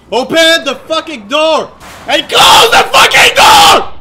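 loud shout climbing to a scream; furious yelling. Real, not acted. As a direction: yell the command at the game, escalating. Yelling at game during a chase.

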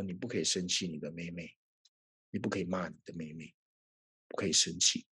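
soft, low, hushed divine warning; quiet inner voice of God; God's gentle voice. Retold or imitated. God gently tells him not to scold sister, soft and low.

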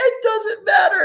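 put-on high, slow, shouted kid's taunt; spring the trap like a gleeful eight-year-old; son's gotcha shout. Son pranking his dad, high slow shouted taunt. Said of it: "He'll be like".

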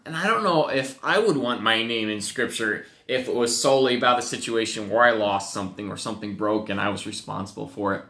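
usual speaking voice

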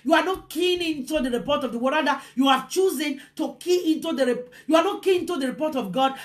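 normal talking voice

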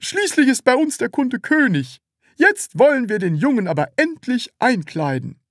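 high, forced-cheerful salesman voice; forced smile. A narrator's reading. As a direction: put the smile back on. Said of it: "bemühte sich wieder zu lächeln".

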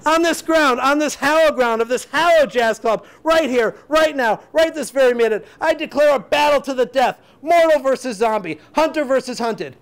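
high, loud grandiose proclamation; hammed-up shouted oration, phrase by phrase; awfully dramatic. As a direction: declaim a battle challenge to the whole club. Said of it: "You're sounding awfully dramatic".

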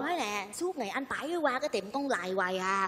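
soft gossipy undertone; confidential hush. Acted. Woman passing on gossip to Anh Bảy, confidential hush.

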